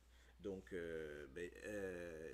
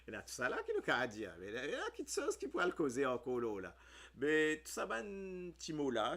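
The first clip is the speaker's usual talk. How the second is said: giggling excitement; 6 semitones higher, 8 dB louder than usual. Real, not acted. Laughing excitedly at the word.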